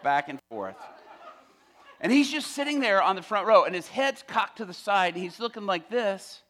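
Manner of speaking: ordinary talk, nothing marked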